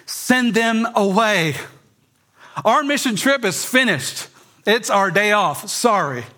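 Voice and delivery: lightly mocking the weary disciples; impatient disciples. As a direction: brush the crowd off like tired helpers. Disciples voiced wanting crowds gone.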